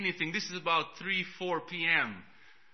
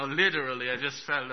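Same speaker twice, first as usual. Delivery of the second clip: usual speaking voice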